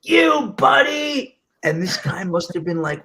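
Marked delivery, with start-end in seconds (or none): 0.0-1.4 loud, high Long Island shout; full Long Island accent. Retold or imitated. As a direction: yell it like an aggravated Long Islander. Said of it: "I was full Long Island".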